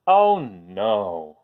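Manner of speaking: ordinary delivery, nothing marked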